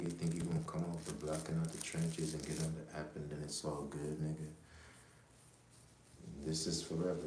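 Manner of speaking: ordinary talk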